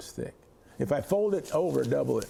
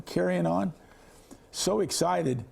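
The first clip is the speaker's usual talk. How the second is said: ordinary talk, nothing marked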